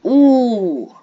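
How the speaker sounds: loud drawn-out ooh. Real, not acted. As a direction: belt out an ooh. Loud exclaim at game.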